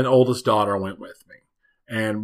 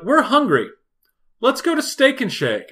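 high mock family voice; 10 semitones higher than usual. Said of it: "they're like".

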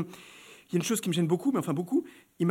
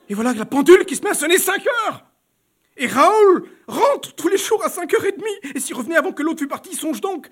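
high, loud alarm; panicked; 9 semitones higher, 7 dB louder than usual. Acted. Baroness reliving the clock striking, high and loud. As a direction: blurt it out in rising panic.

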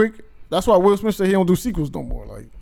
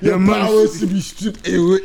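slow mock African film accent; laughing parody of an African elder's accent; Forrest Whitaker; slower than usual. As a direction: drag out a ritual decree in a put-on African accent. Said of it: "Forrest Whitaker's shit".

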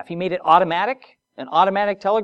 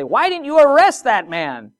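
higher, louder scolding demand; mock scolding; 8 semitones higher, 5 dB louder than usual. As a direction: rebuke them like a superior. Superiors scold the guards.

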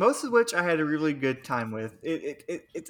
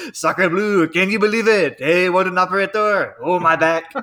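put-on French accent; 3 semitones higher, 6 dB louder than usual. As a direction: grumble it in a broad cartoon French accent. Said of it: "It was such a good imitation".

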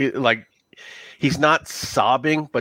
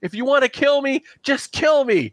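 desperate NPC; 11 semitones higher than usual. Acted. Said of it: "He goes".

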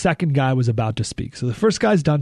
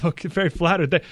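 usual speaking voice

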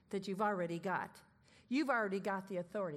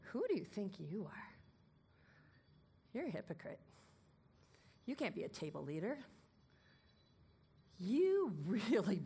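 accusing voice; 3 semitones lower than usual. Retold or imitated. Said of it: "this voice comes at you and says".

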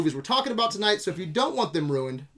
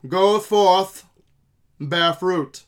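slow, louder mock-archaic proclamation; old-timey voice; 2 semitones higher, 8 dB louder, slower than usual. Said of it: "[name]'s got one".

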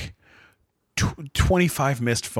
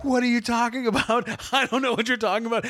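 higher, protesting imitation; bewildered ex-girlfriend; 10 semitones higher, faster than usual. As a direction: mimic her confused denial at a higher pitch. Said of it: "And she's like".

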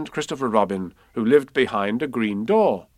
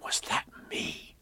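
slow, hushed whisper; awed voice; 12 dB quieter, slower than usual. A narrator's reading. Boy asking if he is in the story, awed whisper. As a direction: whisper it, hardly daring to believe. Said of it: "said Christopher Robin in an awed voice".

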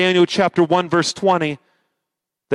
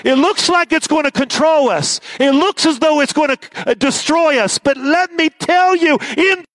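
rousing preaching; 8 semitones higher than usual. Acted. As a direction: build to a triumphant shout. Preaching builds to shout.

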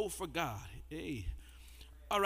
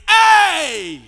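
louder, higher Hey; shout; 7 semitones higher, 10 dB louder than usual. Shown as a demonstration. Preacher showing what the fire emoji means. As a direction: punch out a joyful Hey. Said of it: "That fire means".

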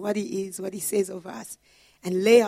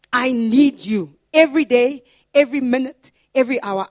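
loud, pleading prayer cry; fervent plea; 3 semitones higher, 8 dB louder than usual. Retold or imitated. Preacher voicing a desperate prayer to God, loud pleading.

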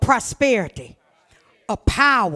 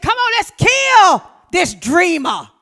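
angry brothers; 5 semitones higher, 6 dB louder, slower than usual. Acted. Brothers plotting against Joseph.

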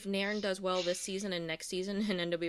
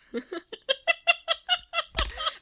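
loud high laugh; 9 dB louder than usual. Real, not acted. Bursts out laughing at the mockery.

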